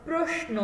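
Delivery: slow প্রশ্ন, s like English; pronunciation demo. Shown as a demonstration. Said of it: "উচ্চারণটা হবে প্রশ্ন এরকম". (দন্ত স দিয়ে প্রশ্ন)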